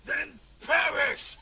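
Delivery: slow, loud villain command; villain roar. Acted. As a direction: boom it slowly. Villain verdict.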